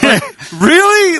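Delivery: high, drawn-out "really?"; exasperated disbelief. Retold or imitated. Storyteller on a pointless spelling check, high exasperated disbelief. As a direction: pitch it up and stretch it, incredulous.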